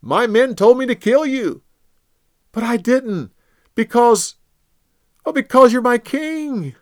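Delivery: high, loud, earnest appeal; David pleading with King Saul from afar; impassioned plea. Acted. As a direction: plead your innocence with all your heart.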